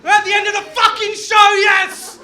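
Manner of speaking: loud, high, thrilled greeting; excited shouting. Real, not acted. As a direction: fire the crowd up. Comic greets crowd excitedly.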